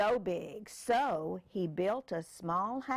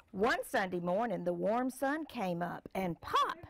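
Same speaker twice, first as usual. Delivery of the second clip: put-on reading to a child; 2 semitones higher than usual. Parent reads picture book aloud.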